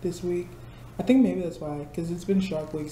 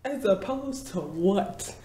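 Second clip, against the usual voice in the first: slow, dry mock question; sarcastic retort; 3 semitones higher, much slower than usual. Answering coworkers with slow sarcasm. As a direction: drag it out, deadpan and sarcastic. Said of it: "I'm like".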